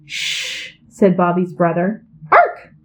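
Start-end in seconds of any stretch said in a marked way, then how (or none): 0.1-0.8 breathy whispered shh; whispered shush. A narrator's reading. Brother whispers a shush at the puppy. 2.3-2.7 a sharp, high, loud yip; high puppy yip. A narrator's reading. Puppy yips back at the brother, high and loud. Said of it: "said the puppy".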